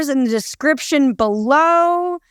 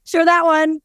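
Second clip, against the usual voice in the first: ordinary talk, nothing marked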